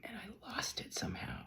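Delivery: whispered regret; regretful whisper about losing his gift; sad whisper. Real, not acted. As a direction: let it slip out, barely voiced. Regret over lost coin.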